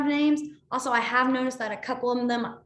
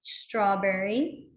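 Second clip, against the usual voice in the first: slow, drawn out; 3 semitones lower, much slower than usual. Shown as a demonstration. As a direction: stretch strawberry out slowly. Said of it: "teaching sign vocabulary".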